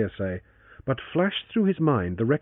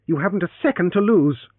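urgent; 7 semitones higher, 5 dB louder than usual. Acted. Urgent plea over snakebite.

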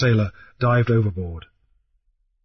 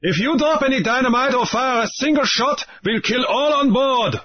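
higher, slowing pirate threat; Raoul crying up; 12 semitones higher than usual. A narrator's reading. Pirate Raoul threatens everyone aboard, crying up. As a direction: threaten them from below. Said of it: "Raoul cried up to them".

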